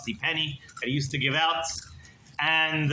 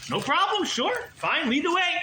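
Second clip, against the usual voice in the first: much higher, quick and eager; eager agreement; 13 semitones higher than usual. Acted. Companion eagerly agreeing to the rebbe, higher and quick. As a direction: chirp yes yes, lead on.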